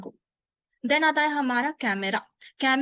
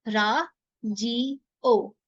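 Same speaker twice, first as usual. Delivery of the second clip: राजियो stretched very slowly; very slow demo; much slower than usual. Shown as a demonstration. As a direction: draw out राजियो for learners. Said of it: "कहते हैं Japanese में राजियो". (राजियो बहुत धीरे)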